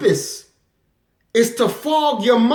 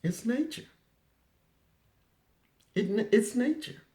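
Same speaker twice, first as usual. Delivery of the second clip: quiet reflection; 13 dB quieter than usual. Real, not acted. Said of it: "whispered afterthought".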